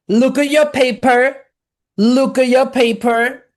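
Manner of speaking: high, loud, stern teacher; strict teacher voice. Retold or imitated. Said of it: "they be like".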